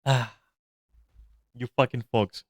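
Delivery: ordinary delivery, nothing marked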